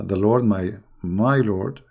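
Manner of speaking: ordinary delivery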